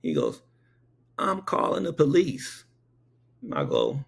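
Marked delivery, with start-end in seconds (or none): 1.2-2.6 higher-pitched retold indignant announcement; lightly acted indignant old man; old man's threat.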